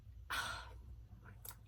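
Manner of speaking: long, soft breathy sigh; real sigh mid-thought. Real, not acted. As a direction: let out a quiet, wordless sigh. Lost for words praising book.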